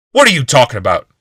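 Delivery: loud, harsh snap of a question; angry growl. A narrator's reading. Old man snapping at the healer, angry growl. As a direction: bark it back, confused and furious. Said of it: "Glaceon growled".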